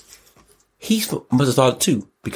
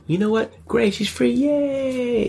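mock cheer; slower than usual. Mock celebrating the release.

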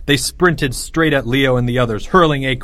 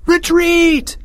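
high, loud shouted command; crying out; 4 dB louder than usual. A narrator's reading. Leo calls his soldiers back, crying out. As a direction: shout it out to the troops. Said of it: "Leo cried out".

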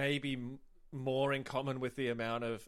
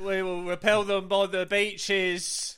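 loud, slow, raised-pitch speechmaking; mock wartime orator; 5 semitones higher, 8 dB louder, slower than usual. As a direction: declaim it like a rousing wartime speech.

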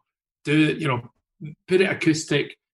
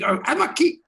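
tiny squeaky voice, much higher; mad wee voice; 3 dB louder than usual. Retold or imitated. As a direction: put on a silly little high voice for the guy at the desk. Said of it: "some mad wee voice".

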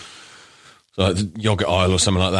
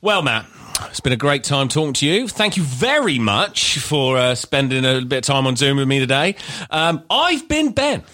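higher, drawn-out presenter voice; podcast sign-off; 6 semitones higher than usual. Acted. Host thanking his co-host at the end, high presenter sign-off. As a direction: wrap the show up with mock formality.

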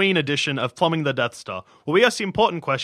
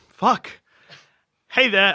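usual speaking voice

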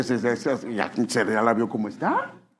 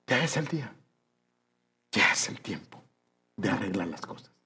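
soft preaching; 4 semitones lower, 7 dB quieter than usual. Real, not acted. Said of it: "preacher drops to soft voice".

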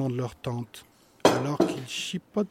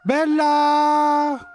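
long loud high call; 13 semitones higher, 10 dB louder than usual. Acted. Agathe still calling for the dog, long loud call. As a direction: hold the name out as you call. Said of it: "continue d'appeler Agathe".

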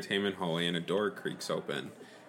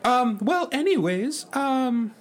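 Mickey the Rat voice; 7 dB louder, slower than usual. Acted. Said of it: "That's not the real Mickey the Rat voice".

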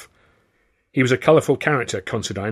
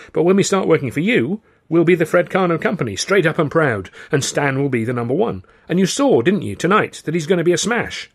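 much higher and louder sales pitch; eager pitch; 7 semitones higher, 5 dB louder than usual. Acted. Narrator talks up Stan to the boss, eager and loud. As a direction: sell it hard with rising enthusiasm.

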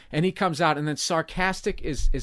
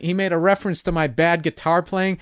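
ordinary talk

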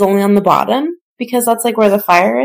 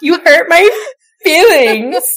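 mock hurt; 8 semitones higher than usual. Jokingly hurt by the remark.

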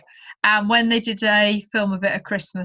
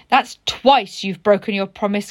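louder, wounded reproach; hurt caterpillar; 3 semitones lower, 4 dB louder than usual. Acted. Caterpillar scolds tadpole a second time, louder and hurt. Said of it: "cried the caterpillar".

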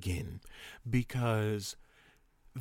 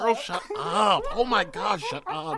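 high, loud shocked shrieking; fear turning to anger; 11 semitones higher, 5 dB louder than usual. Real, not acted. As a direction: scream it in outraged disbelief. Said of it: "I was screaming the entire conversation".

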